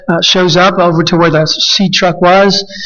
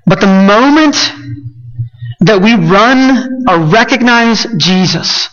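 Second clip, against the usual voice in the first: a preacher building toward the altar call; impassioned preaching; 3 semitones higher than usual. Acted. Preacher urging the church people, impassioned preaching. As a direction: lift the pitch and preach it out slowly.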